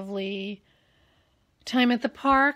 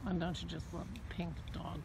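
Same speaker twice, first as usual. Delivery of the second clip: ordinary delivery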